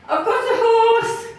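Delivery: high-pitched, panicked-sounding excuse; awkward blurt. Retold or imitated. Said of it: "in my awkwardness".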